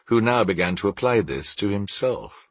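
ordinary delivery

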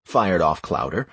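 ordinary delivery, nothing marked

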